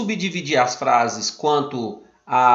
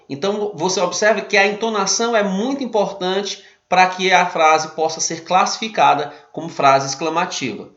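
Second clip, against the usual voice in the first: ordinary delivery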